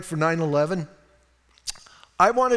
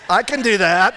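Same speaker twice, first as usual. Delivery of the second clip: loud, sudden burst of eagerness; eager volunteering; 2 semitones higher, 8 dB louder than usual. Retold or imitated. Preacher volunteers to go downstairs, loud and eager. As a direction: jump in loudly, relieved at an easy job.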